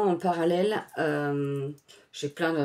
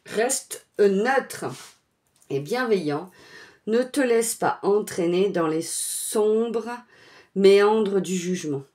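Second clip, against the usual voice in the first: slow, louder solemn card message; oracle card reading; 3 semitones higher, 6 dB louder, slower than usual. Acted. Reading the Gaïa oracle card aloud to viewers, slow solemn delivery. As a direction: read the card's words like a pronouncement.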